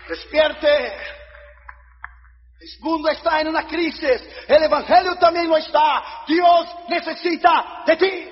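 high, loud revival preacher; impassioned shouted sermon; full-force preaching. Real, not acted. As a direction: cry it out to a congregation, stretching key words.

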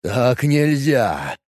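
slow, hollow voice from beyond the grave; sepulchral voice. Acted. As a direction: drag it out like a ghost. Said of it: "всё тем же загробным голосом".

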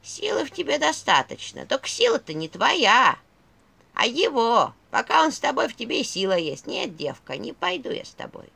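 put-on creaky old woman's skull voice; скрипучий голос черепа. A narrator's reading. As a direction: scold like an ancient crone skull. Skull voice held. (скрипучий старческий голос черепа)

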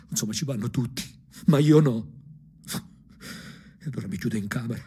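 ordinary talk, nothing marked

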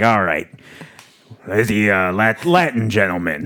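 low, hushed smoker voice of the mom; Mrs O'Rourke voice. Retold or imitated. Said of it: "This is how she talks".

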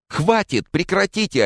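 high-pitched frantic squeal; panicked high cry; desperate shriek. Acted. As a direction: shriek desperately to stop the fight. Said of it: "отчаянно взвизгнула Лиз".

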